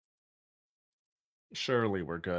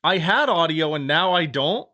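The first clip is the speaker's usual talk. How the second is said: exasperated; 4 semitones higher, 6 dB louder than usual. Real, not acted. Audio suddenly gone.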